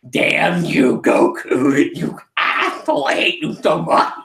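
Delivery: mock-furious villain rant, part hissed; Frieza cursing Goku. Frieza cursing Goku while being beaten, in a hammed-up villain rant. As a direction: spit out a hammed-up curse. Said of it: "And he's like".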